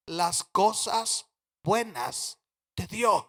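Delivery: slow, low and soft after the climax; hushed reverence. Real, not acted. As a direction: drop right down and space out each word. Sermon climax drops to hush. (reverente, lento y en voz baja)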